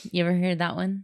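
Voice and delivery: ordinary talk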